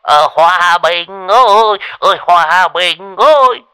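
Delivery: high, loud giggling agreement; shrill laughter. A narrator's reading. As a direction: cave in with a nervous giggle. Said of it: "cười lên choe choé". (cười choe choé)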